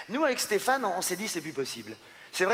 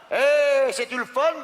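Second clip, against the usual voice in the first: loud, high hammed-up Québécois twang; exaggerated Quebec French yell; mock Québécois accent; 9 semitones higher, 5 dB louder, slower than usual. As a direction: ham up a rural Québécois fishing buddy. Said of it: "Gaétan, qu'on dit Gaétan, pas Gaëtan".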